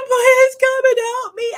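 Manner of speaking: high, loud, tearful begging; high, loud crying plea to husband over the phone; retold bawling plea. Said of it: "I start bawling".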